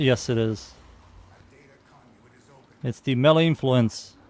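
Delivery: ordinary talk, nothing marked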